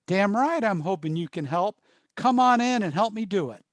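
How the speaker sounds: loud, emphatic retold agreement; forceful demand. Gruff request from smoker asking counselor for help, loud and insistent.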